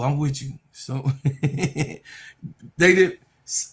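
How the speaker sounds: ordinary talk, nothing marked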